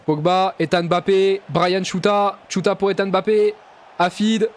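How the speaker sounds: fast, excited French match commentary; live play-by-play. Acted. Gamer calling his own match as commentator, excited play-by-play. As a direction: call the attack like a TV commentator.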